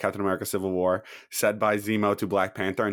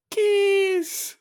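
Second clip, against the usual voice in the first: very high, louder squeal of one word; quoted movie line; 6 dB louder than usual. Acted. Host throwing out random quotes, high mimicking squeal. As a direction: shoot far up in pitch, louder, mimicking the film.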